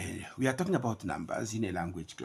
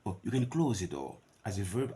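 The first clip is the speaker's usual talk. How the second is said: normal talking voice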